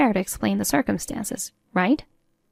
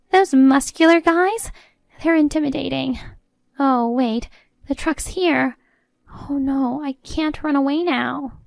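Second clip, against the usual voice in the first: higher-pitched, dismayed mother-in-law voice; cornered and fretting, pitched up; panicked mother-in-law; 6 semitones higher, 3 dB louder than usual. Acted. Mother-in-law sees the truck arrive, dismayed and high.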